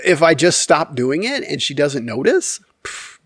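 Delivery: normal talking voice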